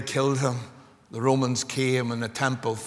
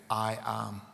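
ordinary talk, nothing marked

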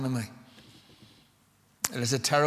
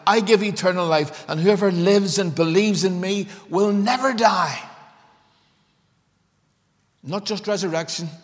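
raised, forceful pulpit voice; impassioned preaching; 6 semitones higher, 8 dB louder than usual. Acted. Preacher pressing Martha's question on the congregation, impassioned preaching. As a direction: drive each declaration home, high and loud.

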